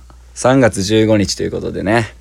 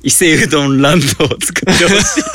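laughing; 3 semitones higher, faster than usual. Real, not acted. Reading absurd news, cracking up.

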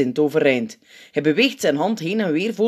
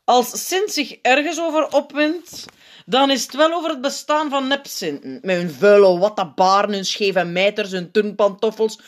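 high, exasperated rant; stilaan kokend; 7 semitones higher, 4 dB louder than usual. A narrator's reading. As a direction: list it with mounting annoyance. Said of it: "begint stilaan te koken". (geërgerde tirade)

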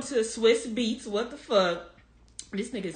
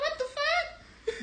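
very high, drawn-out disbelief; retold shock; 5 dB quieter, much slower than usual. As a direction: squeal the question slowly in mock shock.

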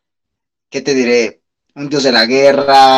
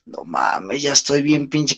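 stoner voice; 2 semitones lower, 8 dB quieter, slower than usual. Retold or imitated. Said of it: "¿cómo decía ese güey?".

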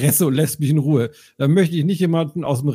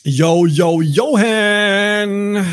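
loud hyped radio greeting; radio morning show hosts; 5 semitones higher, 4 dB louder, much slower than usual. Acted. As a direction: greet like cheesy morning DJs. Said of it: "Wir klingen wie so zwei ... Radio-Morning-Show". (nerviger Morgenshow-Moderator)

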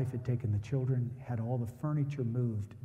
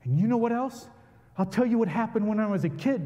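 much higher and louder, worked-up challenge; agitated neighbor; 10 semitones higher, 6 dB louder than usual. Retold or imitated. Angry neighbor in the driveway, higher and louder. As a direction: burst out at him. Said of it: "and he said".

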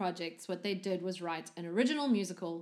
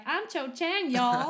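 put-on Texan accent; 6 semitones higher, 5 dB louder, slower than usual. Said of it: "the Texan goes like".

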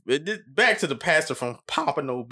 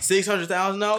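higher repeat of disbelief; amazed repeat, higher; surprised; 9 semitones higher than usual. Real, not acted. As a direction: ask again, still amazed. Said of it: "I mean, bruh".

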